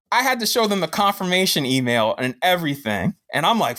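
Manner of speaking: ordinary delivery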